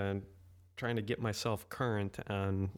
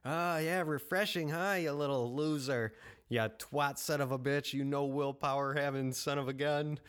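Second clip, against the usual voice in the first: mocking demon voice; 2 semitones higher than usual. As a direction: jeer at him like a gloating demon. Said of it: "I really like that demon voice".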